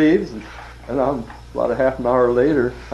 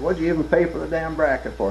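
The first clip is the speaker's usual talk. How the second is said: higher-pitched mock exasperation; retold indignant scolding; 8 semitones higher than usual. Boss scolds rep over the bracket, retold indignant scolding. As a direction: imitate a boss telling you off, higher.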